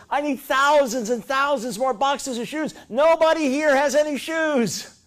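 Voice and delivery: hammed-up delighted shout of a man who sees a market; thrilled salesman.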